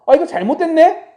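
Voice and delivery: high, loud startled realization; as if catching the mistake just now; acted surprise. Corinthian church realizing the error, surprised.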